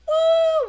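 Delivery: loud, high woo; cheering whoop. Retold or imitated. Fans pounding the table, high cheering whoop. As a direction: whoop like an excited crowd.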